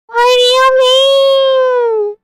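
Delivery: very high, long drawn-out wail; high effect voice. Pretend. Repeats it higher, playing with effect.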